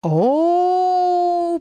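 long drawn-out high oh. A narrator's reading. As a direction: stretch the oh out long. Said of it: "протянул Сигизмунд".

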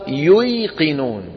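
"ييقنون" slow as yuy-qi-nuun; original unaltered form. Shown as a demonstration. Teacher reveals the word's origin, "ييقنون" slow as yuy-qinuun. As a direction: sound the ya after damma in "ييقنون". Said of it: "أصلها ييقنون".

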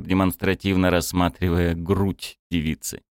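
ordinary delivery, nothing marked

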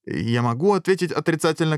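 high, whiny royal question; capricious query. A narrator's reading. King balking at the dragon's question, petulant and high. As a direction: ask it like a sulky spoiled monarch. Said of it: "капризно поинтересовался король".

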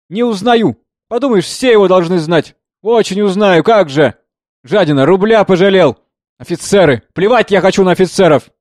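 loud, high, angry berating; scornful tirade. A narrator's reading. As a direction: lash out at him with contempt. Singer scornfully berates the officer. (громкая презрительная брань)

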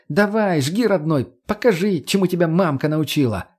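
high, lively coaxing; high-voiced, cheeky encouragement; egging on. A narrator's reading. Margo eggs on her pupil.

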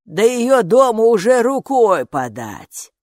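slow, loud, swinging intonation; gatekeeper character. A narrator's reading. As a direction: draw the words out like a knowing gatekeeper. Gatekeeper reassures, shaking head.

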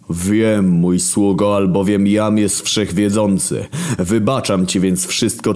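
wounded divine authority; God. Acted. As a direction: speak down from heaven, aggrieved. God speaks to servant.

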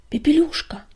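loud urgent call, falling at the end. Acted. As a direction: call her name across the clearing. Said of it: "звала целительница".

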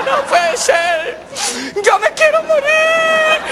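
high, slow mock wail; hammed-up despair. Jilted, fired man giving up, wailing comically. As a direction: wail dramatically, stretching the words.

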